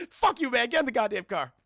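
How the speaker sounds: irritated mock order. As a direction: send your partner off crossly.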